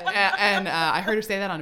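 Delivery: real leftover laughter; laughing talk. Real, not acted. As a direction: keep talking through the laugh. Still laughing after quote.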